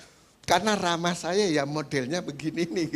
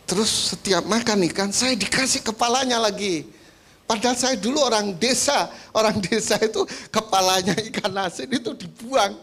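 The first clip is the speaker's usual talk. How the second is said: higher, a hammed-up offended wife; indignant complaint; 6 semitones higher than usual. Wife griping to pastor about fish heads, mock indignant. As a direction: complain with mock outrage, laughing at the end.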